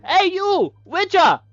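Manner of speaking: high, loud, hammed-up wizard voice; wizard challenger. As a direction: declaim a doomed challenge in a shouted high pitch. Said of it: "I am a wizard".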